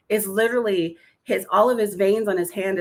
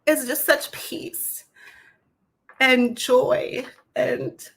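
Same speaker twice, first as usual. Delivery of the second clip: slow, higher voice fighting tears; holding back tears; 5 semitones higher, much slower than usual. Real, not acted. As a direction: speak through welling tears, slowly. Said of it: "try not to cry".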